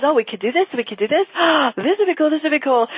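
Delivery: mock-giddy intuitive spilling ideas; excited brainstorming. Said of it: "what happens is, like, boom".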